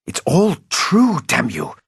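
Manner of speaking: high, loud, slow furious insistence; angry outburst. Acted. Old agent defying Playfair's disbelief, angry loud outburst. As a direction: force each word at him.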